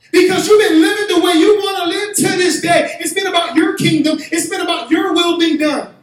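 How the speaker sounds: high, loud, slow fiery sermon; a pastor driving the point home to the congregation; preaching at full force. Acted. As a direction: thunder it from the pulpit.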